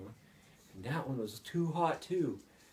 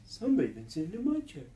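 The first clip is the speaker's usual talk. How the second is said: higher, quicker mother bear voice; lightly raised, fast female bear; Mama Bear; 6 semitones higher, faster than usual. A narrator's reading. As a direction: lift the voice and hurry it like a mother bear. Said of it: "Mama Bear says".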